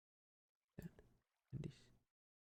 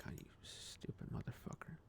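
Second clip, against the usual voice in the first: hushed, nearly voiceless self-insult; muttered self-contempt; 18 dB quieter than usual. Retold or imitated. Retelling insults to own reflection, hushed self-loathing. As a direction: curse yourself under your breath, very softly.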